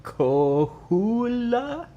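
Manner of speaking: stumbling over a Spanish place name; unsure pronunciation. Real, not acted. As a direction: sound out Coahuila slowly, asking if it's right. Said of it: "how do I say that?".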